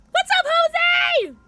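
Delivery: very high, loud, slow sing-song greeting; excited shout-out. Real, not acted. Streamer greets a viewer in chat, very high loud cheer. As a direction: call out a big cheery hello.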